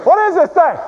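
high, loud, startled question; hammed-up panic at what lies ahead; alarmed disbelief. Rafter staring down at the roaring drop, alarmed disbelief.

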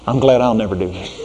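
normal talking voice

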